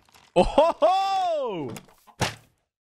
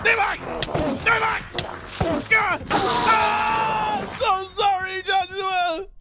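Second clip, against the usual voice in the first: panicked screaming; 6 semitones higher than usual. Real, not acted. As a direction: yell sorry in panic. Screaming apologies while chased.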